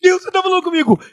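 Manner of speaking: high, loud tearful wail, hammed up; mock crying. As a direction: imitate sobbing out to God in awe. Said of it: "Eu comecei a chorar".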